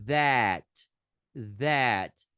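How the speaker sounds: "That" loud, with an open a between a and e; emphatic teacher demo of "That"; English pronunciation. Shown as a demonstration. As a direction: hit "That" with the open é. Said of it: "Então fica é".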